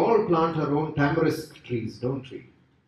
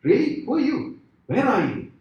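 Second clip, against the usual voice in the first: high-pitched, startled questions; astonished; 9 semitones higher, 3 dB louder than usual. Acted. Dangling man questions the unseen voice, high and astonished. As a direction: play a man amazed that someone answered.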